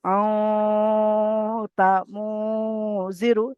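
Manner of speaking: আও তা মু drawn out very slowly through the nose, then জিরু; slow nasal recitation. Shown as a demonstration. Teacher reciting slowly for students to copy, nasal. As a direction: stretch আও তা মু with a long nasal hum. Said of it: "তাহলে কেরা-, কেমন পড়বো?".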